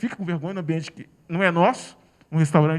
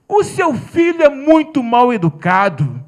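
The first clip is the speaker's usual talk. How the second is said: slow, loud, condescending; contemptuous scold; 4 semitones higher, 10 dB louder, slower than usual. Retold or imitated. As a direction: lay down the insult word by word. Woman scolds about the boy.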